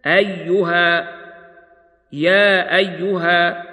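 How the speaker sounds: أيها slowly, stopping on a long final alif. Shown as a demonstration. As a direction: hold the alif at the stop in أيها. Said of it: "ويوقف عليها بالألف هكذا".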